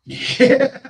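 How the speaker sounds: high laugh through "yeah". Real, not acted. Laughs at her anger.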